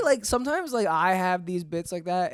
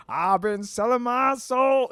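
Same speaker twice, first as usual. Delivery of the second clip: slow Southern song line, pitched up; Southern accent; 4 semitones higher, slower than usual. Shown as a demonstration. Guest quoting a once famous song, slow Southern accent. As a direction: belt the lyric a little, Southern and drawn out. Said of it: "I'll just do it in the accent".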